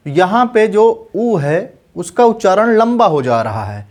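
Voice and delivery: usual speaking voice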